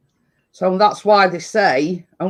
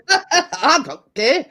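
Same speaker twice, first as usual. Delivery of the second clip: laughing; 7 semitones higher, slower than usual. Real, not acted. Laughing while eating cake.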